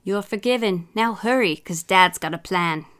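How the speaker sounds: story read in a bad British accent; hammy British accent, lively storytelling; put-on British accent. Mum reading a children's story for laughs, put-on British accent. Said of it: "throw a little accent in there".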